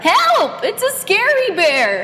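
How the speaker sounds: panicked yelp. A narrator's reading. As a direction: squeal in terror. Rats panic.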